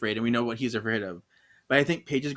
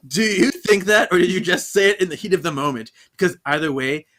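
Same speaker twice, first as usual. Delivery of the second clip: loud, pressing mock interrogation; hammed-up wife demanding an answer; mock confrontation; 3 semitones higher, 6 dB louder than usual. [name] pressing Henry for the truth, loud.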